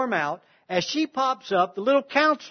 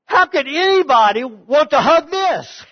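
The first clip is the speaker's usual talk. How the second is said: high, loud cry of disbelief; stunned disbelief; 7 semitones higher, 8 dB louder than usual. Retold or imitated. Woman recalling the counselor's offer to hug her, in loud shocked disbelief. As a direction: blurt it out as if you can't believe anyone would. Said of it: "I couldn't believe it".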